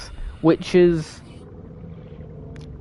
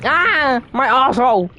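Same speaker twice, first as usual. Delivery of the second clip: sudden higher-pitched cry of alarm; startled yelp; 7 semitones higher than usual. Real, not acted. Streamer interrupted mid-chat by a mishap, startled yelp. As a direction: yelp it out as if caught off guard.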